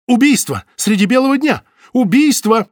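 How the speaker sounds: high, loud alarmed cry; shouting. Acted. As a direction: cry out murder. Butovich cries murder.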